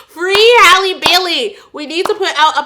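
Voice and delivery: loud rallying chant; shouting. Real, not acted. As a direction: shout it like a protest. Rallying cry for a cause.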